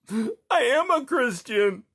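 high, slow, fierce protest; fierce outburst. A narrator's reading. Boy bursting out at the Tsar, fierce. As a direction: burst out defiantly. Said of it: "he burst out fiercely".

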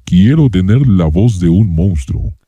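deep processed monster growl; monster voice effect. Shown as a demonstration. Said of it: "Se siente que todavía es [name] la voz".

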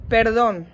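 "Perdón" said as a Spanish model word; Spanish pronunciation. Shown as a demonstration. Teacher showing learners how "Perdón" is pronounced, Spanish pronunciation. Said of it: "Wymówimy ten wyraz w następujący sposób".

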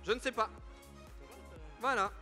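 ordinary talk

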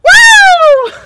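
loud high whoop from the ice; shocked cold whoop. Real, not acted. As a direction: yelp as icy water hits. Ice water poured over him.

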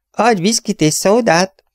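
magas, lassú, határozott rendelés; Mick Huvian. Acted. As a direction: sokkal magasabban, lassan kér italt. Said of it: "mondta Mick Huvian". (Mick Huvian lassan, magasan rendel)

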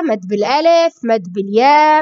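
usual speaking voice

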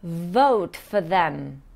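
Vote for them slowly, for with a short weak vowel; patient teacher model; slow demo. Shown as a demonstration. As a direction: draw out vote, keep for light. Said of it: "Once again".

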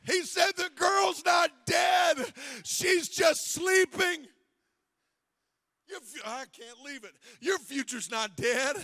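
loud, rising sermon cry; shouted sermon; full-force preaching. Acted. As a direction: preach it out to the whole church.

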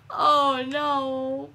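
normal talking voice

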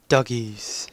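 gasp, then the word in awe; a sudden excited discovery; surprised gasp. Real, not acted. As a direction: caught off guard, delighted. Hears dogs, then gasps.

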